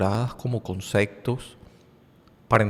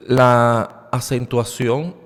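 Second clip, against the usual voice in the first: La acentuación drawn out slowly and louder; emphatic term; 8 dB louder, much slower than usual. Shown as a demonstration. Teacher underlining la acentuación for students, slow and loud.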